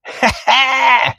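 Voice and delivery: high gleeful mock laugh; uncle's cackle. Uncle crowing at the kids before flooring it, high gleeful laugh. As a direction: crow with glee before stomping the gas. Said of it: "he looks and he goes".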